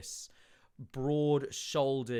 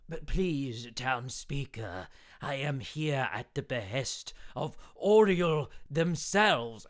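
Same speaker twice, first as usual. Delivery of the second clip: 1 pleading envoy; 3 semitones higher, 6 dB louder, slower than usual. Acted. NPC pleading with town speaker.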